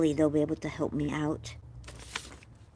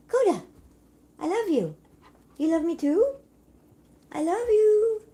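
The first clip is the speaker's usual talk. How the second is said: coaxing pet talk; 13 semitones higher than usual. Real, not acted. Owner coaxing Coda to talk back, high pet voice. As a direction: coo to a pet, pitched way up. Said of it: "if you coax him like that".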